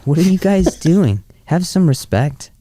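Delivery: high, indignant reproach with amusement; mock scolding. Co-host as winning player scolds fans, mock indignant.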